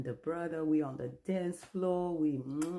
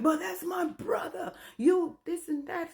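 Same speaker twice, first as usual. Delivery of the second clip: much higher, louder mock yell; retold scream; 10 semitones higher, 4 dB louder than usual. Ex-husband yelling at police about his brother, mock yell. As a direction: yell it like an angry husband at the cop. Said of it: "And then he screamed".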